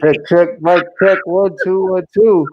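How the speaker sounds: high playful mic check. Pretend. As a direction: play sound engineer.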